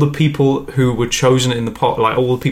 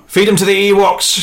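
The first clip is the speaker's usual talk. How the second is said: high, loud villain order; shouted; 8 semitones higher, 4 dB louder than usual. Acted. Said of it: "shouts Palpatine".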